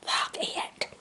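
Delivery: breathy unvoiced whisper, very soft. Real, not acted. Vlogger whispering to viewers about her new cup, whisper. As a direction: breathe it out as a secret aside.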